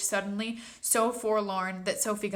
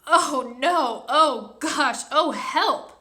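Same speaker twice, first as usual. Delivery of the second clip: loud, higher disgusted cries; 6 semitones higher, 8 dB louder, much slower than usual. Acted. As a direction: cry out at the awful taste. Sophie tasting vile snozcumber.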